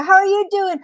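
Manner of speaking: high-pitched coyote calling out; storybook coyote checking on a friend; concerned coyote. Acted. Coyote calls to rabbit.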